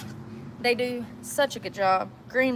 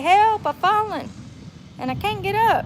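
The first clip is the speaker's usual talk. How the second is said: mock plea; 10 semitones higher than usual. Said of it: "I heard you say".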